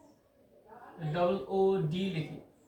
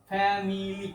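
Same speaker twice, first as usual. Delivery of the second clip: Family drawn out slowly in three syllables; slow three-beat teaching demo of family; much slower than usual. Shown as a demonstration. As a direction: stretch family into fa-mi-li, each syllable distinct. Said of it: "তিনটি দল নিয়ে গঠিত".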